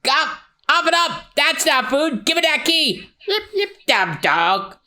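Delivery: high, strained cartoon voice; shouting at the dog. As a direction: yell at the dog to spit it out. Luffy yelling at dog.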